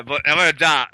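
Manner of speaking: ordinary talk, nothing marked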